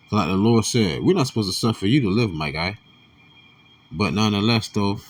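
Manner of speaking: normal talking voice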